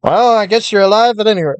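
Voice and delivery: put-on old-time radio announcer voice; lightly voiced vintage newsreel announcer; 1930s newscaster voice. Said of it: "Why am I talking like a nineteen thirties newscaster".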